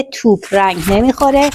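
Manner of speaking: normal talking voice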